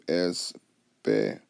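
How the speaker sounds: "espejo" slowly split in three with long gaps; syllable by syllable. Shown as a demonstration. Teacher dividing the word for learners, "espejo" syllable by syllable. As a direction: pause after each syllable of "espejo". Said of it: "So you divide that".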